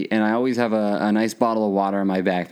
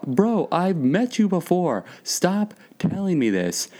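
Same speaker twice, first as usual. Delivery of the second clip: exasperated mock reply; 8 semitones higher, slower than usual. His own exasperated reply.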